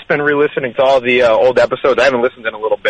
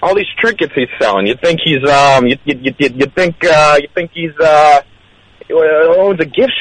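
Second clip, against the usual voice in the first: higher-pitched rambling host voice; host impression; 3 semitones higher than usual. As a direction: stumble along in the host's voice, pitched up. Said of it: "That's pretty good".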